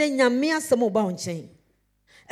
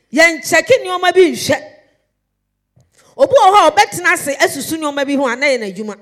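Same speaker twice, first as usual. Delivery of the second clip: preacher pounding the point home, loud; full-force preaching; 5 semitones higher, 8 dB louder than usual. Acted. Preacher urging the church about work and life, loud and fervent.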